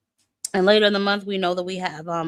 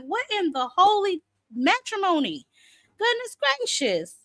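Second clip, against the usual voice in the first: much higher, rising disbelief; mock exasperation; 9 semitones higher than usual. Host retelling her shock at customer service, mock exasperated. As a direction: throw up your hands in mock shock. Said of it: "I was like".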